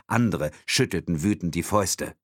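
usual speaking voice

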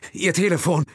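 high, loud, curt demand; urgent demand. Acted. Will demanding Thompson's phone, loud and urgent. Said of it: "forderte er mit offener Hand".

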